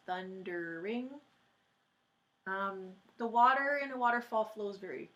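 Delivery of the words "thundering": "thundering" slowly split into thun-der-ing; syllable by syllable. Shown as a demonstration. Teacher showing the class three syllables, "thundering" slowly sounded out as thun-der-ing. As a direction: give "thundering" three separate, lengthened beats. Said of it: "It is three syllables".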